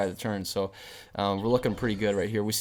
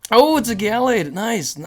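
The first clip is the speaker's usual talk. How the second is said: excited; 9 semitones higher, 8 dB louder, slower than usual. Real, not acted. Lucky prize card pulled.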